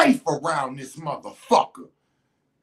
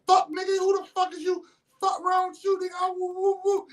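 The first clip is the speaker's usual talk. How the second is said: high mock outrage; 12 semitones higher than usual. Girlfriend startled by his words, high mock outrage. She reacts to his threat.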